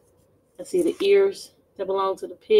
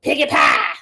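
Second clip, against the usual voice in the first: piggy pie; 3 semitones higher, 6 dB louder than usual. Acted. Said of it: "you guys say it".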